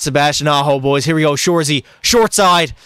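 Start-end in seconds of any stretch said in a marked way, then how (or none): 2.0-2.7 excited call. Real, not acted. Calling the play.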